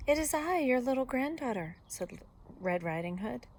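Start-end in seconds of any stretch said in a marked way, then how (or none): 0.1-1.8 put-on high, sweet child voice; little girl. A narrator's reading. Granddaughter at Granny's door, little-girl voice. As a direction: answer in a raised girlish voice.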